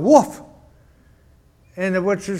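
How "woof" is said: a light, higher playful woof; mock dog bark. Preacher voicing the dog answering the butcher, mock bark. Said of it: "And the dog".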